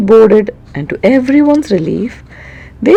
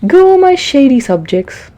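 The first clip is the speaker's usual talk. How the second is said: higher, louder royal proclamation; proclaiming king; 6 semitones higher, 5 dB louder than usual. Acted. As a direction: announce it to the whole court. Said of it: "the king proclaimed".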